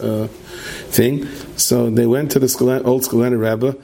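ordinary delivery, nothing marked

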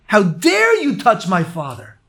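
loud, high indignant cry; outraged. Retold or imitated. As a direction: defend your father with fury. Outraged son defending father.

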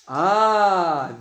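usual speaking voice

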